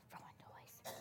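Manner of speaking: tiny unvoiced whisper; boy whispering the answer to the teacher; mumbled whisper. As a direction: barely let it out, like a scared child.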